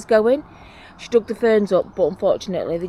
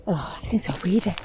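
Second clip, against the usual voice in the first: whisper. Real, not acted. Unvoiced whisper, talking to herself.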